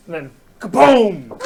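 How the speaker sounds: big slow dramatic kaboom; loud kaboom. Acted. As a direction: boom it out. Narrating the door blown open.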